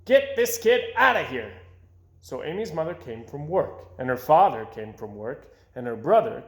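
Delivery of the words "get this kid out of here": high, loud yell; exasperated principal yells, loud. A narrator's reading. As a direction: bark it out in exasperation. Principal yelling.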